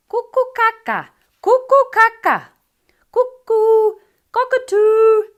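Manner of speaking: very high, loud squawking bird cry; a bird cackling cuckoo, caca at full pitch; cockatoo call. Acted. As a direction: screech it like a cockatoo. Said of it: "burst into a serenade of sounds".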